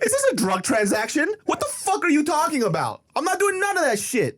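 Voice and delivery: high, strained disbelief near tears; mock outrage at mom; incredulous outburst. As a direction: react as if it's absurd. Outraged at money order idea.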